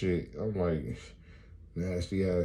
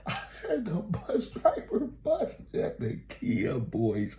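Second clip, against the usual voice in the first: high, drawn-out mock astonishment; incredulous outcry; slower than usual. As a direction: stretch the words out high, hammed-up shock. Climax of the retold bus story.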